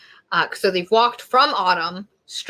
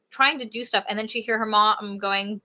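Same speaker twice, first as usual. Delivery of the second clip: normal talking voice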